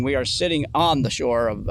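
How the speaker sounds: usual speaking voice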